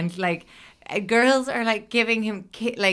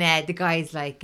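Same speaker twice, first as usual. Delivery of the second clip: ordinary talk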